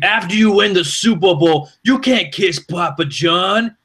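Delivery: high, slow, hammed-up New York sports-radio voice; mock the host's New York rant, stretched and high; Mike Francesa New York accent. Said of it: "his New York accent".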